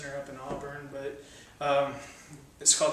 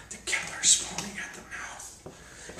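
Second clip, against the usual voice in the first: softer breathy whispered aside; eerie whisper; 4 dB quieter than usual. Acted. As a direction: lean in and whisper the threat.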